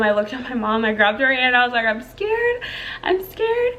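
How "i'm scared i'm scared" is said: very high, thin plea, retelling fear; scared, near tears. Retold or imitated. Mother in labor telling her mom she is frightened, scared high voice. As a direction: plead high as if about to cry. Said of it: "I'm literally about to cry right now".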